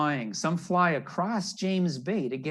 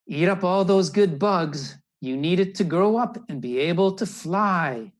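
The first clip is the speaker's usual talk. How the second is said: higher, louder coaxing parent; urging parent bird; 4 semitones higher, 7 dB louder than usual. Acted. Parent bird urges chick to feed before flying, louder. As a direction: coax the chick to eat up.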